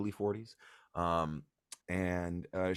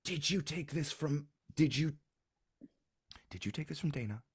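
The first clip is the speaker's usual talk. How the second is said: shocked mother; 8 semitones higher than usual. Acted. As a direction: question a child with dawning alarm.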